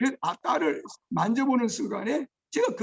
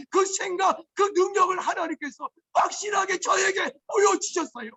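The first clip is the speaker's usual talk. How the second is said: much higher, crying out the climax; a father shouting his testimony through tears; tearful preaching shout; 8 semitones higher than usual. Real, not acted. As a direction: cry it out to the congregation. Testimony climax, preaching at full force.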